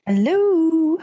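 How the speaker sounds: high, cooed greeting; drawn-out hello. Real, not acted. Co-host greets listeners when introduced, drawn-out hello. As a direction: swoop up high on a long second syllable.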